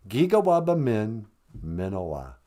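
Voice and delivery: Giga waba min, minowa said steadily, falling at the end; Ojibwe farewell. Shown as a demonstration. Nana teaches Megan the goodbye, clear and even. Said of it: "Try it".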